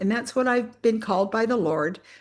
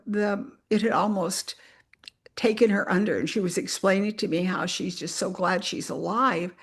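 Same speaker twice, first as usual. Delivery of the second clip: normal talking voice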